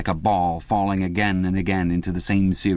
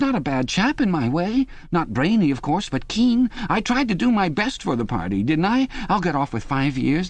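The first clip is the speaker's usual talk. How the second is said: much higher, anxious pleading voice; eager pleading Parsons; 9 semitones higher than usual. A narrator's reading. Parsons pleading with Winston in the cell, high and anxious. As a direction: beg for reassurance, eager and frightened. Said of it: "began eagerly appealing to him".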